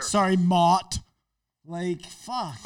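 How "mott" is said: "Mott" said with a broad open o; mocking vowel. Apologizing sarcastically to Matt, "Mott" with open vowel. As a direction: stretch "Mott" in sarcastic correction.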